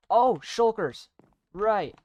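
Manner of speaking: high, loud sudden alarm; startled at the enemies; real gamer surprise; surprised. Real, not acted. Sudden obstacle in parkour.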